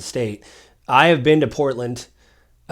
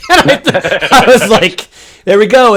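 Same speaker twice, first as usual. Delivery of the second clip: laughing through the words, much higher and louder; 8 semitones higher, 10 dB louder than usual. Real, not acted. Telling a friend the dog punchline, laughing hard. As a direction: crack up while telling it. Laughing at his own story.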